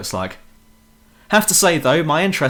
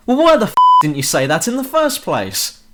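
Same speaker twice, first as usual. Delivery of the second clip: exasperated outburst; 7 semitones higher, 5 dB louder than usual. Real, not acted.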